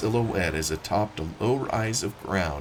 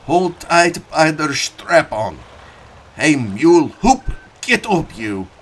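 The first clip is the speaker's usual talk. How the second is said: calling out; 6 semitones higher, 10 dB louder than usual. Acted. Farmer driving mules, calling out.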